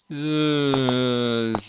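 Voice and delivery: ordinary delivery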